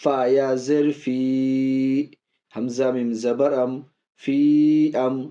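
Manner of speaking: slow, drawn-out spelling and recitation; teacher demonstrating madd munfasil. Shown as a demonstration. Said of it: "اب دیکھ لیجیے مد منفصل".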